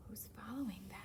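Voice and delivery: soft whispered question; whispered aside. Acted. Reader wonders aloud to listeners, whispered. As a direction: ask it under your breath.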